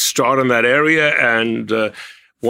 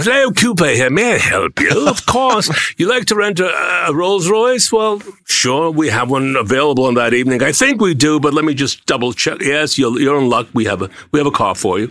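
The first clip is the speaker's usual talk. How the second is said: raised, posh-sounding English phone receptionist; English accent; 3 semitones higher than usual. Retold or imitated. Renting out his car, he answers callers in an English accent. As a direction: answer the phone as a British booking agent. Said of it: "Would you really put on an English accent to answer the phone?".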